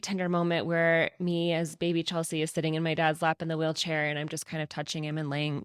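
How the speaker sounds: ordinary talk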